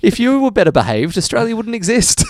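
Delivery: loud, high, laughing indignation; mock outrage. Host blaming the English for convicts, comic outrage. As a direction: rant at them through laughter.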